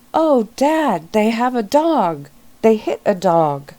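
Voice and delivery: a daughter calling to her dad in dismay; female voice. Acted. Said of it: "That was a female's voice".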